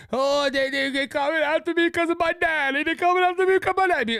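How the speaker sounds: high, whiny mock-crying voice; mocking crybaby Hunter Biden. Mocking Hunter Biden as a crybaby, whiny high voice. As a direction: whine it like a spoiled kid blaming others. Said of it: "Crybaby Hunter Biden".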